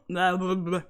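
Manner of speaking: ordinary talk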